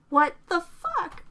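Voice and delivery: slow disbelieving shout. A narrator's reading. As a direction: jump up and shout it out. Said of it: "she shouts".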